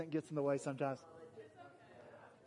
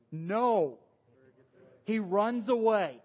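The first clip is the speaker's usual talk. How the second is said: ordinary delivery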